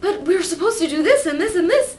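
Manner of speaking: high, loud fretting inner voice; anxious inner voice. Retold or imitated. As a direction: whine out the worried inner voice. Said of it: "this voice is like".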